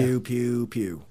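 flat, dropping pew pew; deadpan the laser sounds; bored blaster noises; zero enthusiasm. Acted. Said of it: "with zero enthusiasm".

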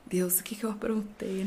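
hushed worried whisper. Retold or imitated. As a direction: whisper it like you're in trouble. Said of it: "eu falo".

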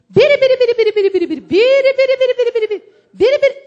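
loud, high rapid-fire babble; imitated American pastor's tongues. American pastor praying in tongues at the altar, loud high babble. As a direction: burst into mock tongues at full voice. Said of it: "o pastor, a orar em línguas".

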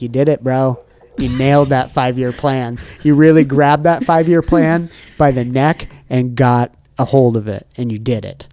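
hype pep talk. Pretend. Pep talk to future self.

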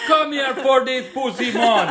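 high, loud mock Jamaican accent. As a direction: put on a big Jamaican voice. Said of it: "doing a great Jamaican accent".

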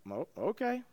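very soft, hesitant acceptance; meek, doubtful agreement. Acted. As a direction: back down quietly, unconvinced. Others doubtfully give in to David.

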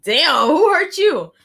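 high, loud, slow taken-aback cry; loud mock shock. Friend stunned by Maya's rude greeting, loud and high. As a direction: recoil loudly, drawn out.

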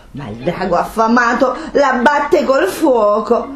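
ordinary delivery, nothing marked

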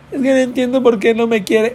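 high mock whiny lament; mock heartbroken whine. Mocking a heartbroken viewer, high whiny lament. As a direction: whine it like a dumped teen. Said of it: "o que andas".

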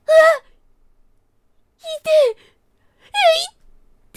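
high, loud yelps of pain. Acted. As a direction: cry out from a sliced tail. Rabbit's tail cut off.

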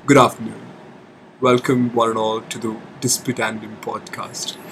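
low, quiet airline captain announcement; pilot voice. Shown as a demonstration. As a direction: welcome them like a pilot over the intercom. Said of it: "Pilot voice".

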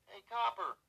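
loud, very high-pitched heckle; high-voiced student hailing an officer; high-pitched call. A narrator's reading. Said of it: "Doug called out in his high-pitched voice".

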